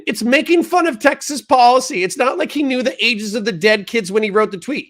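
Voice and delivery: reads the chatter's comment in a high, mocking whine. Streamer reading a chat comment aloud to ridicule it, high mocking voice. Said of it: "why do me dirty with the high voice".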